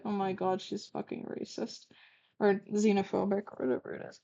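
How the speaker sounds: hushed unvoiced remark under her breath; quiet shocked whisper to the audience; whispered aside. Real, not acted. Aside reacting to the text.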